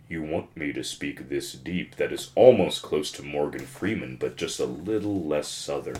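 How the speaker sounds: very low, soft voice-over tone; low and quiet commercial read; deep voice. Shown as a demonstration. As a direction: drop right down into a deep announcer voice. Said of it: "deep voice".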